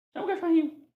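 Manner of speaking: high-pitched playful dog call. Shown as a demonstration. Teacher demonstrating how to call a puppy, high and playful. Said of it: "costumo brincar de chamar o cachorrinho".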